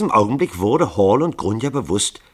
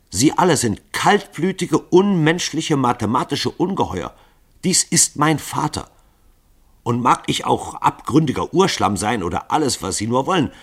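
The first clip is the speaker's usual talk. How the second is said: empört; 7 semitones higher, 4 dB louder than usual. Acted. Grunja verteidigt empört ihren Vater.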